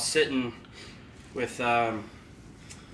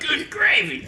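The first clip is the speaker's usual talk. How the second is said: high, cartoonish "Good gravy"; goofy teen; 14 semitones higher, 3 dB louder than usual. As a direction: ham up a dorky kid. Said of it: "he was like".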